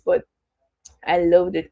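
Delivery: ordinary delivery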